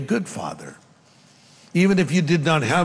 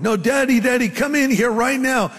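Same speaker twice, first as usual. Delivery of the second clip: put-on much higher-pitched child calling out; insistent scared boy; 8 semitones higher than usual. Scared boy calls his father into the bedroom, insistent. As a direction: imitate a child demanding Daddy come at once. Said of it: "he yelled out".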